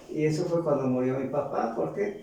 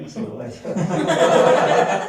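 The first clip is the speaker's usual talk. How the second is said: slow, louder, laughing through the words; 5 semitones higher, 9 dB louder, slower than usual. Real, not acted. Telling the audience, laughing at the punchline. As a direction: laugh as you land the joke. Punchline about the old man.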